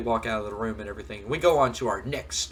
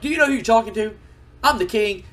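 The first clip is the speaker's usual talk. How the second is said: indignant king; 10 semitones higher, 7 dB louder than usual. Acted. Lawler boasting at Shane.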